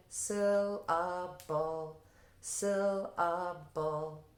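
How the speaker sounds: "Syllable" drawn out very slowly in three separate beats. Shown as a demonstration. Teacher having students follow her, "Syllable" slowly broken into three parts. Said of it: "Follow [name]".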